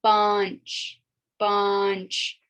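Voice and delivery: "bunch", the n faint; Urdu number five. Shown as a demonstration. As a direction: model "bunch" slowly and clearly. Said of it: "not bench or punch".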